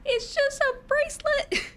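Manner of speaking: put-on very high, loud squealing kid; excited little kid. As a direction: squeal like a proud child. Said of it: "And I was like".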